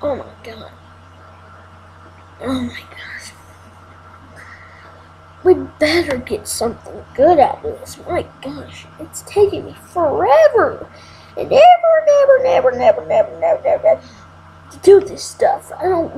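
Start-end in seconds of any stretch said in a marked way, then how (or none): 0.0-3.3 breathless whispered gasp. Real, not acted. Exhausted, muttering to self. 11.4-14.0 high, fast singsong whine; whiny rapid repeat. Real, not acted. Complaining it takes forever.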